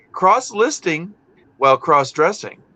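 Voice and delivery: ordinary delivery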